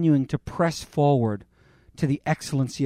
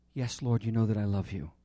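soft reply; 6 semitones lower, 7 dB quieter than usual. Acted. Peter answers Jesus tenderly.

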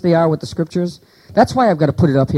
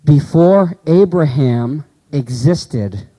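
slow scripture quote; 3 semitones lower, much slower than usual. Shown as a demonstration. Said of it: "Watch this".

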